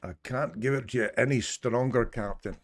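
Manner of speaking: strained Scottish engineer pleading to the captain; hammed-up Scottish starship engineer; Scottish accent. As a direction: push it out in a broad Scottish accent. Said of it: "I had to do Scottish there".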